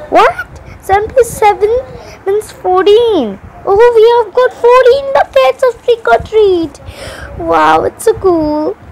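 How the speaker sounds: high excited discovery. Pretend. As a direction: gush over the find, high. Excited at finding buckets.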